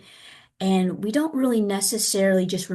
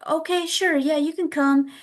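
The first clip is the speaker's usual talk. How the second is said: higher-pitched, easy-going agreement; agreeable parent; 8 semitones higher than usual. Retold or imitated. Parent agreeing to a home visit, high and agreeable. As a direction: imitate a willing parent, pitched well up.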